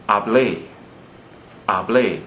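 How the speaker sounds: haBLÉ, weight on the end; final-syllable stress. Shown as a demonstration. Teacher giving a verb form, hablé stressed on BLÉ. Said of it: "when it's pronounced correctly".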